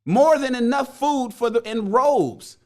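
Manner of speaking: put-on reading the old text. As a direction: read the ancient lines with grand weight. Reading old parable aloud.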